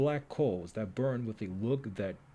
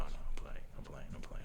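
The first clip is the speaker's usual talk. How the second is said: whispered laughing aside; 5 dB quieter than usual. Real, not acted. Player taking back the joke, whisper. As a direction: hush it quickly. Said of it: "whispered aside".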